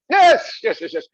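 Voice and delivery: ordinary talk, nothing marked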